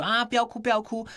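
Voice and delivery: high-pitched mock soothing of a child; fussing parent. Parent to a fallen child, mock fussing. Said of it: "有时候小孩子跌倒的时候".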